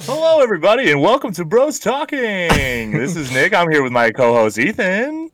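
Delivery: high, slow hammed-up game show host; fake game show voice. Shown as a demonstration. Nick opening the podcast as a cheesy game show host. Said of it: "I'll just have a fake voice".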